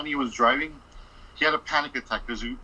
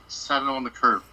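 usual speaking voice

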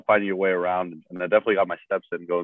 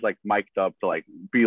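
usual speaking voice

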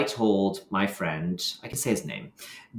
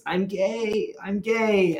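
in tears; 12 semitones higher, much slower than usual. Retold or imitated. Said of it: "did just say in tears".

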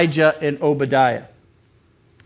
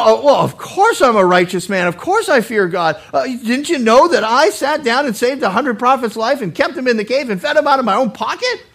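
higher, indignant self-justifying protest; self-righteous Obadiah; 6 semitones higher, 4 dB louder than usual. Obadiah defending his record to the prophet, self-righteous. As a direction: boast with affronted righteousness. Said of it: "how we can think like Obadiah".

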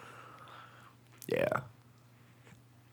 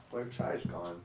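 ordinary talk, nothing marked

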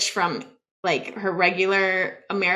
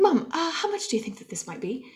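put-on higher, proper British-style accent; lightly put-on English accent, politely asking; mock-English accent, pitched up; Julie Andrews; 5 semitones higher, 4 dB quieter than usual. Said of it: "Julie Andrews".

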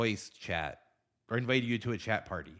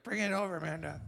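high mock plea; 10 semitones higher than usual. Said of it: "I'm like".

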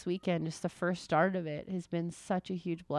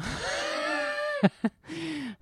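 a high, breaking laugh fighting back tears; emotional laugh; 11 semitones higher than usual. Real, not acted. Mom about to cry on the podcast, laughing.

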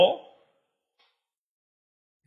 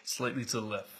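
hushed, focused muttering to himself; quiet inner voice; 7 semitones lower, 11 dB quieter than usual. Retold or imitated. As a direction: murmur it like private thoughts. Voicing LeBron's thoughts while shooting.

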